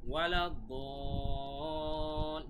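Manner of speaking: "ওয়ালাদ-দাল" with "দা" held extremely long; very long stretch. Shown as a demonstration. Teacher showing four alifs of stretch, very long "ওয়ালাদ-দাল". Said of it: "এখানে চার আলিফ টান হবে".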